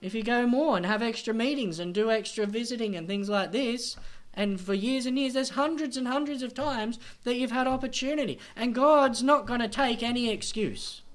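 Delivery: loud, high, driving sermon voice; full-force preaching. Acted. Preacher warning churchgoers they will be accountable, fiery preaching. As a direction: hammer the warning home to the church.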